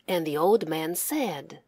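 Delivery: plain reading voice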